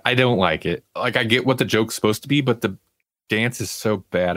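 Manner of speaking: normal talking voice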